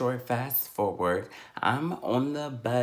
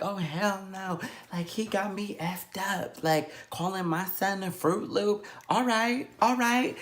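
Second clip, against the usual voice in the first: indignant mother; 8 semitones higher than usual. Retold or imitated. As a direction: imitate a mom working herself up. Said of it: "I just hear her like".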